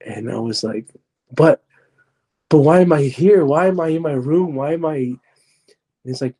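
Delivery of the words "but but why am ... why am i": higher, insistent retold questioning; bewildered protest. Storyteller asks the voice why he's here, bewildered. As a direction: push each why up in pitch, protesting.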